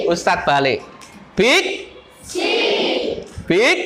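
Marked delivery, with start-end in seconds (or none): 1.4-3.8 raised, rising call; seruan berintonasi. Shown as a demonstration. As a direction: prompt the class with a rising call. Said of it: "harus dijawab dengan intonasi". (seruan bernada naik)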